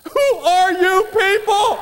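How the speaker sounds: high, slow, overwhelmed question; tearful awe. Retold or imitated. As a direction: sob it out in disbelief. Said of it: "she's just sobbing".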